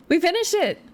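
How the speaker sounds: high and thrilled; excited. Real, not acted. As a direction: cheer the finish. Celebrating finishing chapter.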